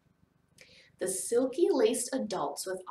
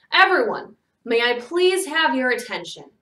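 loud, projected public address; raised, commanding but polite; calling for attention; 5 semitones higher, 11 dB louder than usual. A narrator's reading. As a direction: call a noisy crowd to order. Master addresses the whole crowd.

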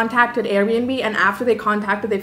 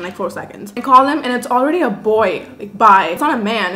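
usual speaking voice

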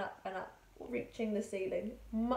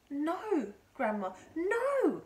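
high, loud, drawn-out refusal; calling back; 7 semitones higher, 8 dB louder, much slower than usual. A narrator's reading. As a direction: shout up in alarm, stretching the words. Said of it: "George called back".

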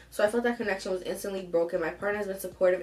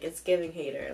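ordinary delivery

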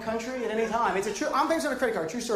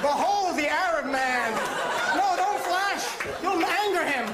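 higher, grand exhibit announcer; mock showman; 7 semitones higher, slower than usual. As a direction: present a wild exhibit, then warn the crowd urgently. Mock show-and-tell exhibit presenter.

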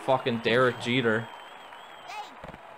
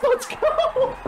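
thrilled victory shout; excited yell; 13 semitones higher, much slower than usual. Real, not acted. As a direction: yell it out like you just won. Hyped home run celebration.

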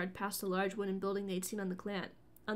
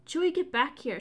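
sharp loud snap, higher; snapped; 6 semitones higher, 8 dB louder than usual. A narrator's reading. Leia snaps at Chewie to come back, loud and sharp. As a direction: bark an order at him. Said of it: "she snapped".

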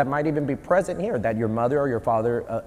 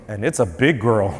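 dropped, low imitated doctor voice; low-voiced mock announcement; changed doctor voice; 5 semitones lower than usual. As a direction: drop the pitch as the doctor. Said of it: "his voice changes and he goes".

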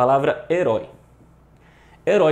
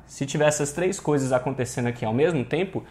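ordinary talk, nothing marked

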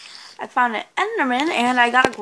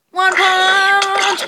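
loud, high battle cry; battle shout; 9 semitones higher, 9 dB louder than usual. Acted. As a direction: shout it as you swing. Battle cry while punching mob.

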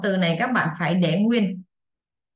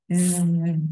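long, low-key "z" buzz; 3 semitones lower than usual. Shown as a demonstration. Teacher showing how ES sounds, buzzing z. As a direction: hold a voiced z on the teeth. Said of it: "phát âm cái chữ E S này".

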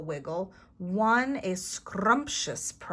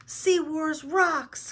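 high, loud, drawn-out cheer; acted burst of play excitement; excited cry; 9 semitones higher, 8 dB louder, much slower than usual. A narrator's reading. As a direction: crow it slowly and loudly. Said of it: "cried Clark".

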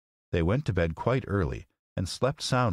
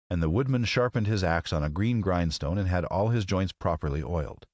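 plain reading voice

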